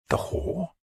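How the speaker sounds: soft, breathy, puzzled query; a king's hushed doubt; uncertain question. Acted. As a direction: ask it unsure of the word. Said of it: "Alfred asked uncertainly".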